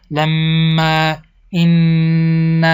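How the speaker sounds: very slow, humming nasal consonants in لما، این; nasal ghunna. Shown as a demonstration. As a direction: hold the doubled m in لما and the n in این as a long nasal hum.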